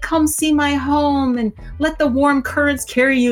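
lightly voiced dragon. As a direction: invite them home like a kind dragon. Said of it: "She's like".

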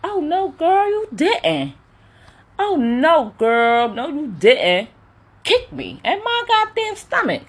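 very high, squealing shock; sky-high mock horror; shocked Kendall. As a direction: gasp it out as the appalled sister with hand over mouth. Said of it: "I was like, Kendall".

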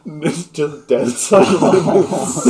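loud, slowed by real laughter; laughing hard. Real, not acted. Game master describes dead silence at feast, cracking up. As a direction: barely get it out for laughing.

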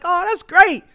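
high-pitched, pleased reply; happy. Acted. Job seeker hearing from the employer by phone, happy. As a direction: let the voice lift with delight. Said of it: "You happy".

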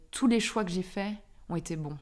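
ordinary delivery

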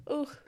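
sharp high groan of pain; pained high ugh. Real, not acted. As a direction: yelp at the burn. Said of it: "I just burnt my mouth".

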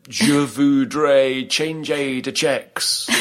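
put-on slow, stilted English-accented French read from a phrase book; terrible French accent. English tourist reading French to a clerk in a bad, slow English accent. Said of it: "in this really terrible reading out French accent".

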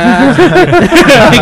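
loud high laugh bursting out; burst of laughter. Real, not acted. Host cracking up at a guest's remark, loud laughter.

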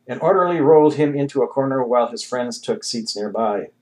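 plain reading voice

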